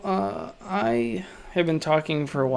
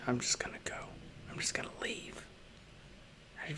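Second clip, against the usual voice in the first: hushed whisper, barely voiced; 15 dB quieter, much faster than usual. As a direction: sneak off in a whisper. Playful fake exit, whispered.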